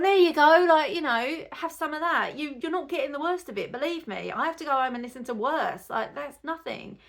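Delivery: very loud, high sarcastic jeer, easing off; imagined sarcastic taunt. Pretend. Mum imagining taunting the old man with her crying toddler, loud then easing. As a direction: shove it in his ear, mocking. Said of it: "I should have lifted her up to his ear holes and gone".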